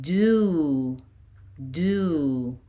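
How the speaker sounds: "Do" as a drawn-out doo; "Do" stretched into one long doo; long O syllable. Shown as a demonstration. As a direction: hold the oo in "Do" for pupils to copy. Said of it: "we add O".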